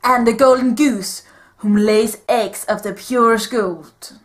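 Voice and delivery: put-on Puss in Boots style Spanish accent. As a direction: heroic swashbuckler in a Spanish accent. Held Puss character voice.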